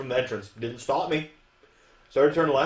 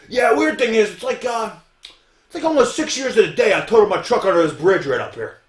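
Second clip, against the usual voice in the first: louder, higher voice of a chatty stranger; imitated local guy, loud and higher; voiced passenger; 8 semitones higher, 9 dB louder than usual. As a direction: play the passenger telling his odd tale loudly. Acting out the passenger's odd story.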